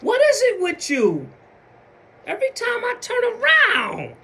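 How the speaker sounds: high-pitched acted shout; rising hammed-up exasperated yell; exasperated outburst. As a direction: blow up at them in mock frustration. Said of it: "And you like".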